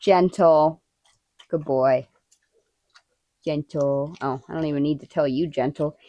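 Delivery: low, soft and calm to a dog taking a treat; hushed, patient "gentle" for the dog; soothing dog voice. Real, not acted. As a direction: coax the dog to take it gently.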